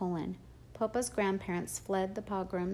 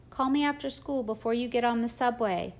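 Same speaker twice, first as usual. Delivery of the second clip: louder call from the window; yelled from the window; 4 semitones higher, 5 dB louder than usual. A narrator's reading. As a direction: call after her down the street. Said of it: "Mom yelled out".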